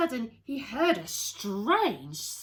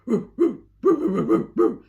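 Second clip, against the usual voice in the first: loud string of ruffs; barking dog; 3 semitones higher, 12 dB louder than usual. Acted. Bottomley Potts the dog barks, loud. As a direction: bark over and over. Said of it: "said Bottomley Potts".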